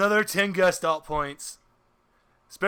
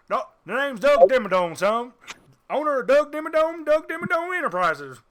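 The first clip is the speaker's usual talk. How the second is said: Doug Dimmadome voice; 7 semitones higher than usual. Acted. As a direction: brag like a cartoon Texan tycoon. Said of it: "you're doing the Doug Dimmadome voice".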